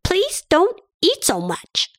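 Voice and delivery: tummy politely asking to stop; overfull tummy voice. Acted. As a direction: beg gently to stop eating. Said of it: "It's saying".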